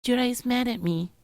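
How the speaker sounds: put-on high, small sulky girl voice; Mia's voice. Mia sulking to Jurai in a high girlish voice. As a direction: pout it out like a hurt little girl.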